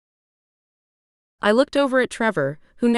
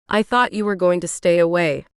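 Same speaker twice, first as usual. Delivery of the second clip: plain reading voice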